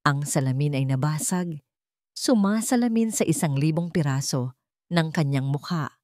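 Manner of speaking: ordinary talk, nothing marked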